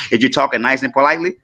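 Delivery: ordinary delivery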